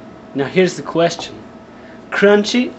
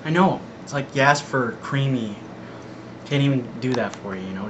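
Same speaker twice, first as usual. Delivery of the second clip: usual speaking voice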